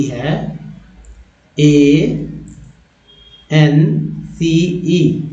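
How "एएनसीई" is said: spelled very slowly. Shown as a demonstration. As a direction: spell एएनसीई letter by letter, very slow. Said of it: "तब भी इसे NC पढ़ेंगे".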